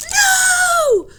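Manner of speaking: very high, very loud scream. Acted. Annie screams at the captain, very high and very loud. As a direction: scream it with all you have. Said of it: "screamed Annie".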